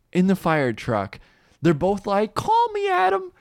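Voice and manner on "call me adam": very high mock calling-out; exaggerated falsetto farewell call. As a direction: sing out after someone driving away. Twins calling after departing truck.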